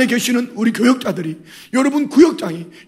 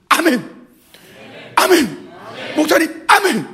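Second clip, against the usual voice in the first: shouted amen; 8 semitones higher, slower than usual. Shown as a demonstration. Preacher shows the congregation how to answer amen. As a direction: cry amen with growing fervor. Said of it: "이래야 됩니다".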